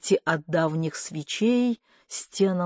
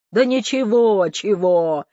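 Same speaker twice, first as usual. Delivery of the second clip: loud, higher burst of irritation; exasperated outburst; irritated; 3 semitones higher, 7 dB louder than usual. A narrator's reading. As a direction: snap back, annoyed and loud. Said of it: "Дядя Сёма стал раздражаться".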